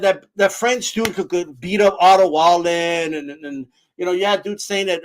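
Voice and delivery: usual speaking voice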